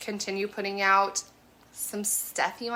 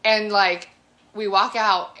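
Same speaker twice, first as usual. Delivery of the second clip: normal talking voice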